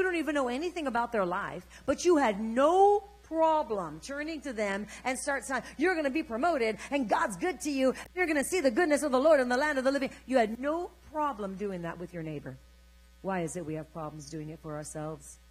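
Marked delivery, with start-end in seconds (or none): 5.8-10.1 loud, raised preacher's encouragement; enthusiastic blessing. Acted. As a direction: pour out promises on your neighbor. Re-enacts blessing a neighbor.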